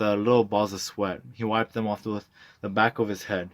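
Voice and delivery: ordinary delivery, nothing marked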